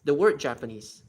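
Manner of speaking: ordinary delivery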